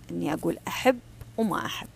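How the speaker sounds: ordinary talk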